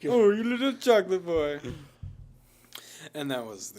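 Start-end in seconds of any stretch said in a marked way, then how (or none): 0.1-1.6 teasing voice. Acted. Teasing voice of Chocolate Boy.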